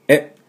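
short え made at the back of the throat; throaty "eh". Shown as a demonstration. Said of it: "喉の奥で出すでしょ". (喉の奥で出す短い「え」)

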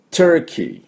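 Turkey high, short ter-ki; English Turkey. Shown as a demonstration. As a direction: model Turkey brightly up high. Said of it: "こんな感じです".

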